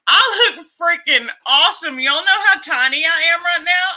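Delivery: bursting with pride, almost crying; excited. Real, not acted. Excited shout to viewers about her new body. Celebrating her weight loss on stream.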